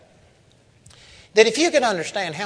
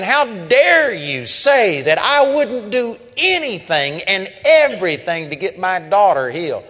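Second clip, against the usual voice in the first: incensed, retold; 5 semitones higher, 6 dB louder than usual. Insulted father to the preacher about healing his daughter, imitated incensed. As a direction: act out an outraged father raising his voice. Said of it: "he was just incensed, saying".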